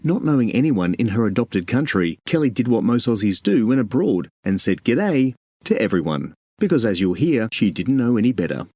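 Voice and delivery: smooth host voice introducing the episode; podcast intro read. Acted. Host introducing the comedian to listeners, announcer read.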